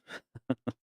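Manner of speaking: brief soft giggle; a quick, quiet chuckle at yourself; short laugh. Real, not acted. Gamer amused by his own silly voice, short laugh.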